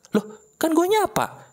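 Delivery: high-pitched, taken-aback defence; puzzled protest. Acted. Medan friend defending his greeting, puzzled and high-pitched. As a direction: protest innocently, voice jumping high. Acted reply to being scolded.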